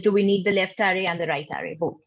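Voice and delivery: ordinary delivery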